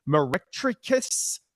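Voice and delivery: "Meretricious" sounded out slowly as a question; tentative, drawn-out attempt at reading "Meretricious"; unsure pronunciation. Real, not acted. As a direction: stumble slowly through "Meretricious", unsure of each syllable. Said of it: "what is this word?".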